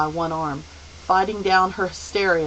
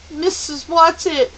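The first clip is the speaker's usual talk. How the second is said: higher, louder despairing call; 10 semitones higher, 7 dB louder than usual. Acted. Meg calls for her absent guide in despair. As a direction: call out for help that won't come. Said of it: "Meg called despairingly".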